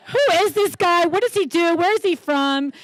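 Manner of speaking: imitating her husband. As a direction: play the baffled overprotective husband, hammed up. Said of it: "He was not as excited as I was".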